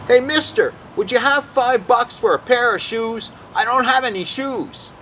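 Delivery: high-pitched begging voice; imitated pleading stranger. Soaked man asking a passer-by for money, pleading.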